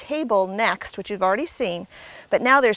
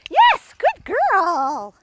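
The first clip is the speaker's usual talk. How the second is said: drawn-out praise; 7 semitones higher, 5 dB louder, much slower than usual. Real, not acted. Praising dog after jump.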